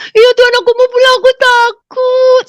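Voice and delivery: put-on high, loud child voice, slowing on the last words; small child pleading, afraid; childlike fearful plea. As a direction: beg like a frightened little girl. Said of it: "kita tuh kayak anak kecil".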